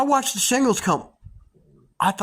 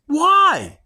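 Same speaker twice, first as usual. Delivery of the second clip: sharp high loud "Why?"; startled shout; 9 semitones higher, 5 dB louder than usual. Retold or imitated. To the driver threatening his boss, shocked shout.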